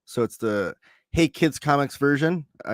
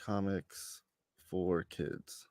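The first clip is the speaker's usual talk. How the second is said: muttered to self; 6 semitones lower, 15 dB quieter, much slower than usual. Real, not acted. Muttering while looking up number.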